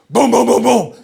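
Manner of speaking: forceful "boom" knock imitation; authoritative pounding. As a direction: imitate a heavy fist hammering the door. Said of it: "we hear this very authoritative".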